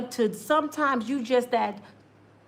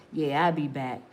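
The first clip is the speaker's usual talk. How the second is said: low man voice; 8 semitones lower, 4 dB quieter than usual. Retold or imitated.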